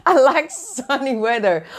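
light laugh into the words. Real, not acted. Telling viewers she likes sunny weather, laughing. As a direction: giggle through the line. Laughing about liking sun.

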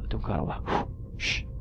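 soft, quick hushed whisper; hushed sneaking. Real, not acted. Gamer sneaking up to snipe an enemy, hushed whisper. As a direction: keep it down while sneaking up.

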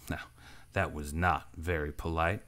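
quiet danger under polite words; low looming threat. A narrator's reading. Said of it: "said the stranger dangerously".

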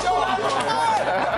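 put-on Jamaican accent. Player performing for guessers, high-pitched Jamaican accent.

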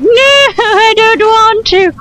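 high, drawn-out whine of mock fear; whiny scared figure. Scared statue refusing an order, whiny. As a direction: plead like a frightened child, hammed-up. Voices frightened character refusing.

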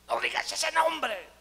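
high, loud, drawn-out demon shriek; imitated demonic yell, slow and high; possessed woman's voice. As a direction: drag out the demon's screamed protest. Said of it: "no era la voz de ella".

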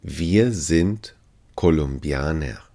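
ordinary talk, nothing marked

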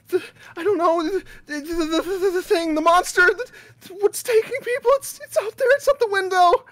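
high, breaking, frightened voice; frantic woman pointing at the window; panicked stammer. Acted. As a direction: stumble over the words in terror. Panicked woman stammering about monster.

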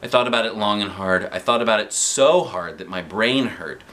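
plain reading voice

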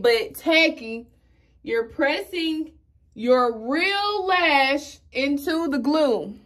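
very slow, drawn-out teaching voice; slow tutorial explanation; slow instructing. Shown as a demonstration. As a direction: talk them through it slowly. Slow makeup instruction demo.

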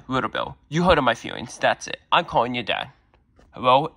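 usual speaking voice

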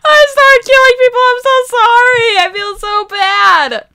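high, laughing guilty apology; giggling and half sorry. Real, not acted. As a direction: say sorry while still laughing. Laughing apology after killing players.

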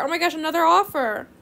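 normal talking voice

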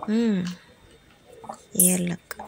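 ordinary delivery

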